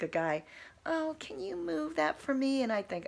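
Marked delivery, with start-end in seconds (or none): 0.8-2.6 much higher, sugary mock plea for help; mocking helpless woman. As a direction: play a helpless woman asking for help, high. Mocking helpless women asking guy.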